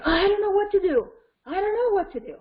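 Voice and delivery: high-pitched, fast, frantic wail; matched intensity. Shown as a demonstration. Parent matching an upset child, high frantic wail. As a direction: throw the child's distress back, pitch way up. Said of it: "you can match the responses".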